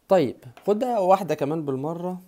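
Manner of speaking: ordinary talk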